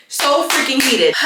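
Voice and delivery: high, slow, emphatic frustration; heated. Real, not acted. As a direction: draw it out, exasperated. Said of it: "I am so heated".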